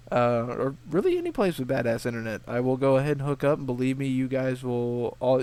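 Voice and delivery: ordinary delivery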